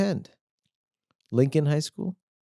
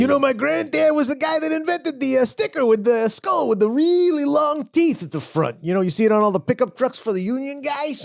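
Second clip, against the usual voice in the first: mock future grandson; 11 semitones higher than usual. Riffing as future descendant.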